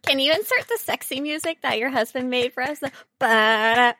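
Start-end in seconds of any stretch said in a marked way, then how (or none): none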